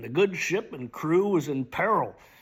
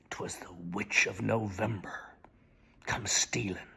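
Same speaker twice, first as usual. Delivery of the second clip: whisper; 7 semitones lower, 6 dB quieter than usual. Acted. Dramatic poem recitation.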